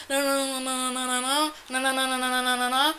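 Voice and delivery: a light imitation of the mother's rapid refusals. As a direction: shut it down over and over.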